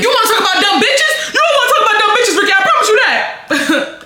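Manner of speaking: high-pitched indignant retort; triggered outburst. Retold or imitated. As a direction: fire back, voice shooting way up. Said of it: "the words that triggered a bitch".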